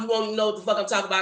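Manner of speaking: fast talk. Shown as a demonstration. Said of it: "I can talk so fast and talk so country".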